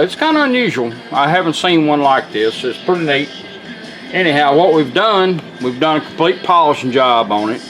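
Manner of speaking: normal talking voice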